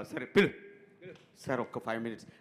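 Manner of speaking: usual speaking voice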